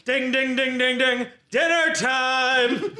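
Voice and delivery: imitated dinner bell, then drawn-out call; wrestler's catchphrase call. Cunning Waiter opens his promo, drawn-out dinner call. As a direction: ring out the dings and stretch the final call.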